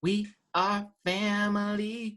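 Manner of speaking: high, slow sing-song category call; sing-song announcer. Pretend. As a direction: stretch it out high, like a refrain. Repeats category higher, sing-song.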